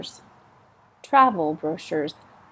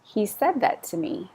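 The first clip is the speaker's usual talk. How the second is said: ordinary talk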